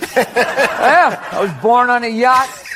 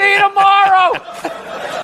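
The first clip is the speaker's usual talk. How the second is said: mock shout; 10 semitones higher, much slower than usual. Pulling up, calling goodbye.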